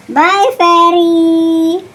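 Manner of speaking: drawn-out singsong farewell; playful, lilting bye-bye to a pet; teasing goodbye. Pretend. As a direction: pretend you're leaving the dog behind, stretching the words.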